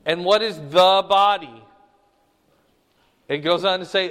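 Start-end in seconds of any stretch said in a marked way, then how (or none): 0.6-1.6 THE held long and firm before body; stress on THE. Real, not acted. Teacher quizzing class on what this means, stress on THE. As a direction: lean on THE, body falling. Question on underlined phrase.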